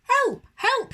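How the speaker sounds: high, loud cries of help; yelling for help. Acted. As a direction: shout it like a panicked little owl. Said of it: "he yelled".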